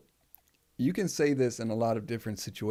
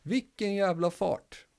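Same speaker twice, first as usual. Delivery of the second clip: "Vilken jävla fart" high and a little slow; amazed exclamation; 7 semitones higher than usual. Shown as a demonstration. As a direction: marvel at the runner's speed. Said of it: "Oh, look at him go".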